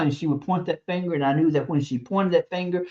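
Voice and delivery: usual speaking voice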